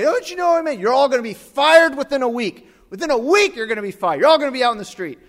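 high, loud ranting threats; screaming. Retold or imitated. Angry customer storming out of the store, screaming. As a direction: rant at everyone at the top of the voice. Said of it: "screaming the whole way".

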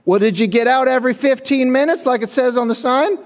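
high, loud mock boss voice; scolding boss. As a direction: scold him in a high put-on voice. Boss scolds worker about sign.

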